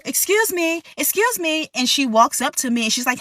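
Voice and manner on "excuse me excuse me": high, pushy excuse me; demanding customer. Retold or imitated. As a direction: call out like an entitled guest. Guest calls again.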